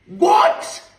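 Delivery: shrieking high disbelief; shocked. Real, not acted. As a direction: blurt it out in pure shock. Outraged disbelief at pastor's act.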